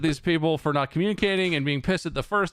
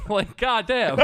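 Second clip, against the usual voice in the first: slow goddamn then a high laugh; real exasperated laughter; laughing exasperation; 4 dB louder, slower than usual. Real, not acted. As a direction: laugh in disbelief.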